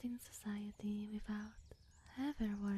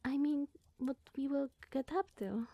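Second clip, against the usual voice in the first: usual speaking voice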